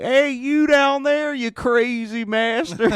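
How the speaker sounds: put-on hollering down from a rooftop in a thick Philly accent. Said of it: "that was my Philly accent".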